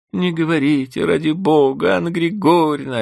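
pleading. A narrator's reading. Vanda begging again, high plea. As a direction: beg for mercy. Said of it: "упрашивать Ванда".

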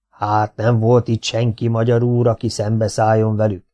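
ordinary delivery

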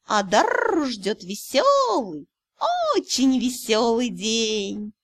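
slow, drawn-out and sly, rising high; smug lazy cat. Acted. Cat lecturing the heroine, smug and drawling. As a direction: purr it out like a pleased lazy cat.

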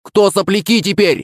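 high-pitched crowing taunt; goading taunt. A narrator's reading. Friend goading rivals after equaliser, high teasing crow. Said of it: "начал их подначивать Мишка".

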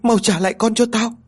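high, distraught demanding voice; insistent demand. A narrator's reading. Distraught mother refusing comfort, demanding her child back. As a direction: demand it desperately, pitched right up. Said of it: "Huê vẫn liên tục đòi con như vậy".

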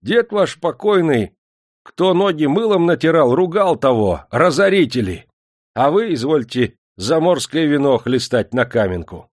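loud scolding; indignant reproach. A narrator's reading. Manager scolding his master over wasted wine, loud reproach. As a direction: rebuke the spendthrift master.

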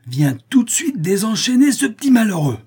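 high, loud, angry command; terrible-tempered yell; fierce shouted order. A narrator's reading. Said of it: "d'un air terrible et lui crie".

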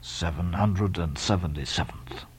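very low, soft, slow drone; deep, unmoved old Quaker; absent muttering. Acted. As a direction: repeat it without looking up from your book. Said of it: "without lifting his eyes".

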